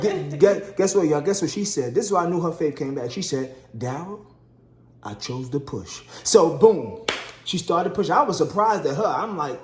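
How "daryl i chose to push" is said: low, soft retold declaration; subdued, lower-pitched quote; hushed resolve. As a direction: say it quietly as the wife who has decided. Wife announcing her grave choice.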